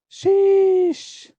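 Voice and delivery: high exasperated sheesh. Real, not acted. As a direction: squeal out a disappointed sheesh. Disappointed pack opening reaction.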